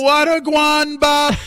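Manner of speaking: put-on slow, very high parrot with a Jamaican accent. Imitating [name]'s parrot greeting, slow Jamaican accent. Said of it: "Did he have a Jamaican accent?".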